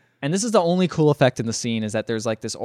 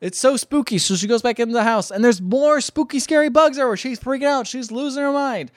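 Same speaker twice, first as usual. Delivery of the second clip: high-pitched, agitated storytelling; frantic retelling; 10 semitones higher, 3 dB louder than usual. Retold or imitated. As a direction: pile up the horrors in rising alarm. Acting out the heroine's frantic panic.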